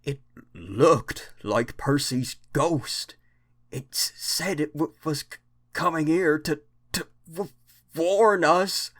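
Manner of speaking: slow, stammering frightened voice; shaky voice. Acted. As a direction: stutter it out, scared. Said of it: "said Toby in a shaky voice".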